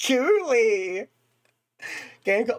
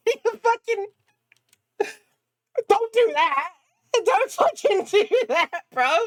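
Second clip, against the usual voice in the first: laughing protest; 11 semitones higher, 3 dB louder than usual. Real, not acted. Scolding the game after a scare.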